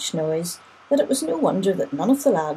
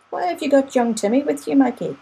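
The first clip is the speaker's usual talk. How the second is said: put-on sharp, raised boyish exclaim; 7 semitones higher, 4 dB louder than usual. A narrator's reading. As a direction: call it out in surprise. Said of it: "I heard Danny McGuire exclaim".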